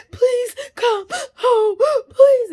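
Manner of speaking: high, loud mock sobbing plea; imitating hysterical crying. Teen begging stepdad to come home, mock hysterical crying. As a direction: wail it slowly, gasping through exaggerated sobs. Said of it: "hysterically crying".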